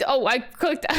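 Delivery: normal talking voice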